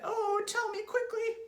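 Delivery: very high, loud wail; Mrs. Twit's pleading cry. A narrator's reading. Wife begging husband for the cure, high cry. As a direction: beg in a squeaky, desperate voice. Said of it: "she cried".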